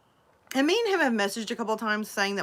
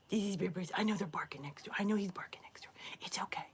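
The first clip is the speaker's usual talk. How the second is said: very soft whisper to a pet; whispered soothing; 11 dB quieter, faster than usual. Real, not acted. Owner soothes dog over barking next door, whispering. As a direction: hush and reassure in a whisper.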